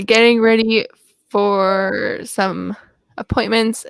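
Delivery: halting read-aloud with pauses between phrases; slow careful reading. Real, not acted. Slightly dyslexic reader working through a message aloud, slow careful reading. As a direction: pick through the words slowly, checking each. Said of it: "look how slow I have to read".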